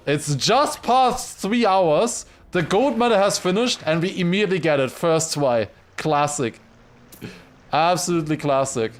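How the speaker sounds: loud, high ironic disbelief; mock cheer after hours of failure; exasperated sarcasm. Real, not acted. Sarcastic after three hours of play.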